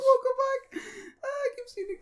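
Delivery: very high, whining comic voice; high-pitched pretend wail; mock high squeal. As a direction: squeak it far above your normal pitch. Comic outburst as picture reappears.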